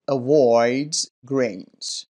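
slow "avoids" with the z ending sounded; pronunciation demo. Shown as a demonstration. Teacher checking answer four, slow careful "avoids". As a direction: draw out "avoids grains" carefully. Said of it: "طریقهٔ تلفظ درستش رو".

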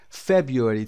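"February" said as FEB-yoo-ree, stressing FEB; careful pronunciation. Shown as a demonstration. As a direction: model "February" slowly for the class to copy. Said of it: "تلفظ رو دقت داشته باشید".

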